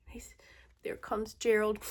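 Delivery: whispering. Real, not acted. Whispered aside.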